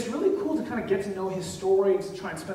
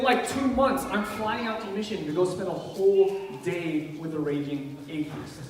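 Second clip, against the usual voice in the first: ordinary talk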